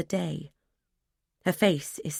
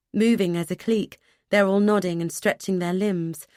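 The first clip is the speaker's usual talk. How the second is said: ordinary delivery, nothing marked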